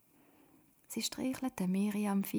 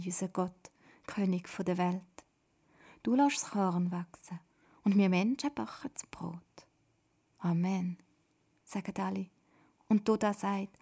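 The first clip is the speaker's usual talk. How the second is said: plain reading voice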